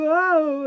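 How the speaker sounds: high drawn-out spooky moan; ghostly wail. Shown as a demonstration. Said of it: "It's like a proper kind of ghostly sound".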